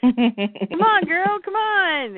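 stretched, rising mock plea; urgent coaxing. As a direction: plead for someone to wake up. Character urging another awake.

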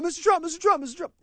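high-pitched, insistent mock calling out; clamoring reporters. Screaming press pack calling on the candidate, mock clamor. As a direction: imitate reporters jostling to be picked. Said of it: "all of the screaming and yelling".

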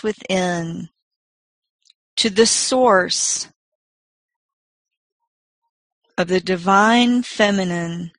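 slow, soft meditation voice; guided meditation. Acted. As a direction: gently guide them inward, unhurried.

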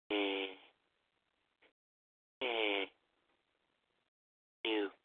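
drowsy mumbling, drifting off; sleepy fading murmurs; falling asleep. Acted. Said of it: "I fell asleep there".

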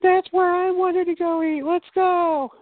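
very high, eager put-on mom voice; excited mom. Mom hearing her favorite restaurant named, excited. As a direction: imitate Mom thrilled to get her pick.

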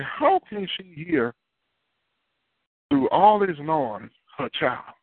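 high, drawn-out disbelief; incredulous, slow and high; amazed onlookers. Retold or imitated. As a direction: stretch it out in wonder, pitched well up. Onlookers voiced asking in amazement.

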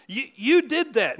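high, loud finger-pointing blame; mock accusation. Shown as a demonstration. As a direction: accuse them in hammed-up reproach. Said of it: "that's not Jesus' demeanor".

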